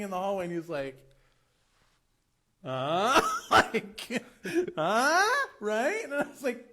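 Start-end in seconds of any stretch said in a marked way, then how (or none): 2.6-3.2 drawn-out "huh?" sliding sharply upward; loud, rising wordless prompt, imitating a proud kid; questioning "huh?". Kid showing off the flat to guest, questioning "huh?". 4.8-5.5 high, loud "huh?" swooping up; questioning "huh?". Retold or imitated. Kid fishing for guest's approval, questioning "huh?". Child's proud prompting noise repeated.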